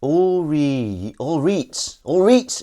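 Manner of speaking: loud, high Geordie "all reet"; Geordie accent. Shown as a demonstration. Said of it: "Isn't that Geordie?".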